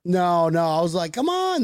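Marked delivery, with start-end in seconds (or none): 1.1-1.6 high, stretched coaxing plea; pleading. Retold or imitated. Fan urging friend to join in, pleading. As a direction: coax in a high imitation of yourself.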